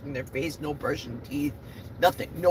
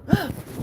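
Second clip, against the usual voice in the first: startled gasp; 13 semitones higher than usual. Real, not acted. Camera bounces.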